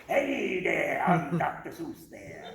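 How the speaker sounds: mild Dr. Seuss voice, slow and low; Dr. Seuss impression. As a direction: play a folksy old author introducing himself. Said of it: "Pretty accurate".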